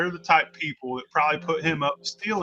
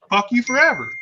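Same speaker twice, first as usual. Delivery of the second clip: retold angry snap; 6 semitones higher, 4 dB louder than usual. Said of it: "Try your shit with other people".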